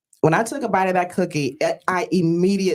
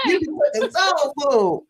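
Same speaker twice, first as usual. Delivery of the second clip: sassy echo; 9 semitones higher than usual. Acted. Host echoes guest playfully.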